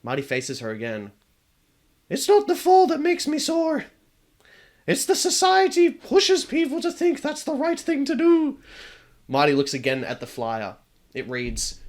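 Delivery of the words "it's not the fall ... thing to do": put-on very high, louder girl voice with a wry edge; the ghost shrugging off her fate; high wistful ghost girl.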